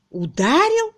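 high, loud, astonished question; incredulous echo. A narrator's reading. Harriet to Miss Twitterton, incredulous. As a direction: echo the word in disbelief.

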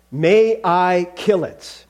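very slow, weighty question; relentless angel. Acted. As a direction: draw out each word, immovable.

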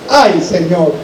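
ordinary delivery

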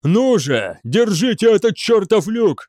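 high, loud battle shout; yelled urging. A narrator's reading. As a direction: spur the men on under fire. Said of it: "вопил Дев". (криком подгонять солдат)

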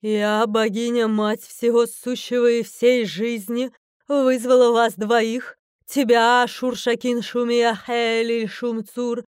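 loud, slow, stately proclamation; majestic. A narrator's reading. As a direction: proclaim it as a supreme deity. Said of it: "снова величественно начала". (величественно, громко и медленно)

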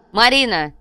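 high, loud calling of the name; sharp call. A narrator's reading. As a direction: call out to jolt her. Companion calls to the shocked girl.

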